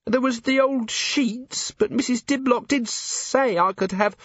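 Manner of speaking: put-on high, timid servant girl; nervous young maid. A narrator's reading. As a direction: stammer it out, frightened.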